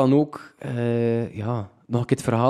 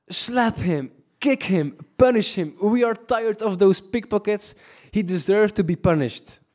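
lightly voiced locals egging him on, pitched higher; angry locals; 8 semitones higher than usual. Bar locals urge the robbed tourist to punish the thief, hammed-up anger. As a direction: call out like bar regulars who want the thief punished. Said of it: "die reactie van de locals was zalig".

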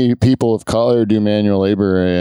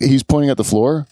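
ordinary talk

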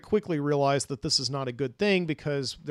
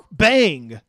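sudden, much louder burst; loud bang; 2 semitones higher, 11 dB louder than usual. Retold or imitated. Storyteller mimicking a tire blowing on the bus, loud bang. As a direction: imitate an explosive bang, loud and abrupt. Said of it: "there's, like, this another loud".

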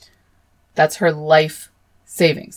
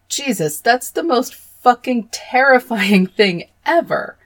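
much higher, louder and slow, appalled; horrified outburst; 7 semitones higher, 4 dB louder than usual. Real, not acted. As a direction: recoil from the horror out loud. Horrified reaction to killer's quote.

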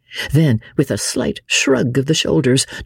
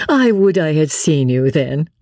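higher, amused and laughing; merry laughter; 6 semitones higher than usual. A narrator's reading. Marguerite mocking her husband's boxing boast, merry. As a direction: tease him on the edge of a merry laugh. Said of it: "with a long and merry laugh".

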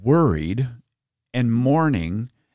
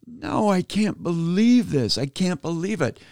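dismayed disbelief; 7 semitones higher than usual. Retold or imitated. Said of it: "And I'm thinking".

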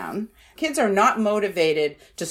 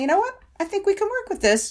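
cheerful parent; 12 semitones higher, faster than usual. Retold or imitated. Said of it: "the parent might be saying".

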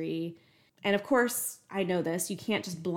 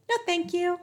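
high, mock-polite sing-song; sing-songy; 11 semitones higher, 4 dB louder than usual. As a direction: imitate a sickly-sweet refusal in high sing-song. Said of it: "In the most sing-songy voice".

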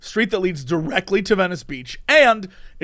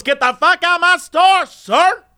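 high, loud furious yell; furious clerk; 9 semitones higher, 5 dB louder than usual. Retold or imitated. Clerk ejecting the man from her store, furious yell. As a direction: throw him out hard.

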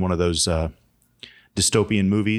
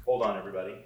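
away from the mic; 5 dB quieter than usual. Real, not acted. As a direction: voice distant from the microphone. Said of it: "I'm gonna move away from my microphone".

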